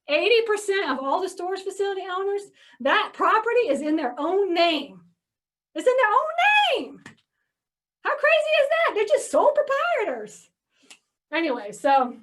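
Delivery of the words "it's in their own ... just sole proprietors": high, loud disbelief; can you believe it, way up high; amazed. Real, not acted. Telling viewers owners are sole proprietors, amazed and high. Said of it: "amazed aside".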